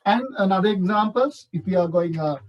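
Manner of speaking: usual speaking voice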